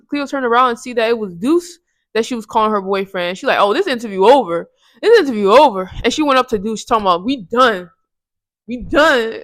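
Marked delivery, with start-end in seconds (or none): 3.6-5.9 louder, huffy cut-off; indignant. Retold or imitated. Cleo ending Frankie's interview, loud and indignant. 7.2-9.4 louder, final breakup line. Retold or imitated. Cleo telling Deuce it's over, loud and final.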